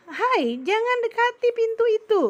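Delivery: high, loud warning call; warning shout demo. Shown as a demonstration. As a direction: shout it like stopping a child at the door. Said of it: "itu meninggi nadanya kalau kata seru". (teriakan peringatan yang tinggi dan keras)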